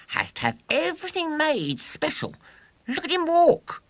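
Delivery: put-on high, loud boastful showman boy. A narrator's reading. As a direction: crow it like a swaggering boy showman. William boasting to audience.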